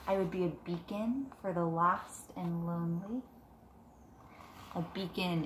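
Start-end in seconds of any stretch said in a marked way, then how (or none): 0.1-3.2 put-on reading to children. Teacher reading Moon Wishes to her class, gentle storybook voice. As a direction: read it softly to a class of kids. Reads picture book aloud.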